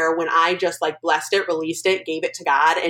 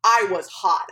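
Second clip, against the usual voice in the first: ordinary delivery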